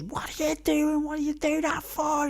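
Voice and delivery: high-pitched imitation of an outraged mum; imitating mum telling off. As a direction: squeak out mum's shocked telling-off. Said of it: "obviously me mum goes".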